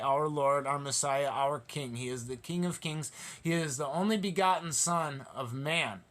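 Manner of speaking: usual speaking voice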